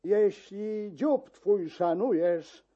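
ordinary delivery, nothing marked

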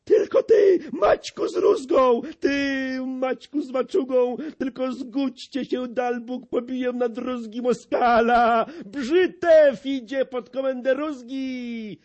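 high, loud, rushing pledge; eager high shouting. A narrator's reading. Brzytewka pledges himself to Maciek, high and eager. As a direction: fire them up, squeaking with zeal. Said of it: "pisnął".

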